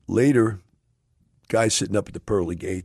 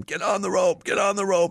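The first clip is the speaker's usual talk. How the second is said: much higher, yelling up from the helicopter; retold screaming; 3 dB louder than usual. Sheriff in the helicopter screaming at the stranded man, high yell. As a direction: scream the order over the flood. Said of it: "he's screaming at him".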